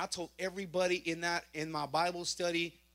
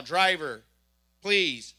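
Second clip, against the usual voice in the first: police command; 3 semitones lower, 7 dB louder, much slower than usual. Retold or imitated. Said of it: "She's all".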